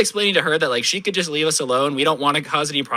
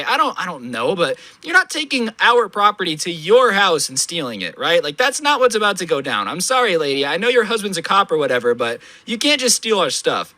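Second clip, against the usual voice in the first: ordinary talk, nothing marked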